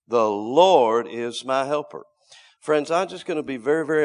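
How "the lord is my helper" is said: loud, slow, weighty declaration; bold confession. Shown as a demonstration. Preacher repeating the scripture to stir up friends, boldly. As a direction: declare it firmly, drawing out each phrase. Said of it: "but to be boldly spoken".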